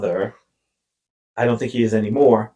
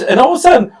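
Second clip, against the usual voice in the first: ordinary talk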